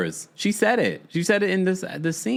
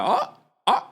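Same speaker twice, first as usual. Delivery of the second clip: imitated group gasp, high-pitched; short, high shocked "oh"s, mimicking onlookers; mock shocked reaction; 8 semitones higher than usual. Everyone reacts shocked to Toya's line.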